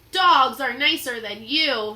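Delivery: loud cheeky insult; defiant retort. Acted. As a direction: sass the pirate. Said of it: "said Annie".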